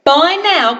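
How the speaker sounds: plain reading voice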